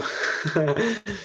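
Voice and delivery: quiet, amused chuckle; soft laugh. Real, not acted. Teacher amused by a student's word, soft laugh. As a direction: laugh softly under your breath. Amused while reading the chat.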